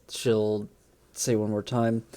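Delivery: usual speaking voice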